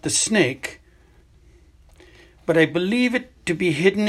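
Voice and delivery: put-on an old headmaster explaining gently but seriously; Dumbledore. Headmaster tells student about the hidden cave, grave and measured.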